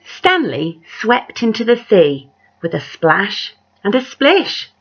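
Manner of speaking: lively read-aloud with big rises on the refrain; story narration. A narrator's reading. Reading a picture book aloud to children, animated storyteller voice. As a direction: tell it like a bedtime picture book.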